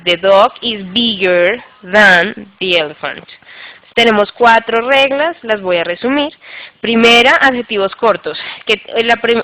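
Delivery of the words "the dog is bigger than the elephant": slow example sentence. Shown as a demonstration.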